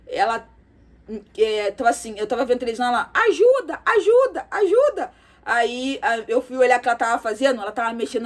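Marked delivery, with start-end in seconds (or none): 3.1-5.1 put-on high, loud little-girl cries of ajuda; toddler crying for help. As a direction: play the toddler calling out for help. Niece calling for help, imitated.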